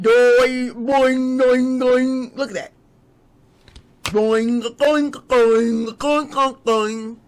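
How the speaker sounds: springy boing noises, loud and playful; bouncy springy boingy voice. Retold or imitated. Mimicking silly bouncing.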